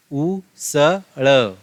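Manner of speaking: उसड़ stretched very slowly; drawn-out reading. Shown as a demonstration. Teacher reading उसड़ aloud to students, very slowly. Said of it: "अब आगे देखो क्या है".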